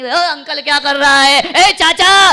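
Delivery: loud, high, slow rude yell; rude shout at uncle. Retold or imitated. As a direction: bark it at your own uncle disrespectfully. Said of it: "uncle जी कह करके नहीं".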